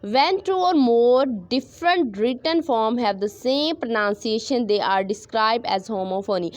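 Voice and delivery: very slow, word by word, for students to write; slow dictation. Shown as a demonstration. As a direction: read the definition out slowly like dictation. Teacher dictates definition. (धीमी आवाज़ में dictation)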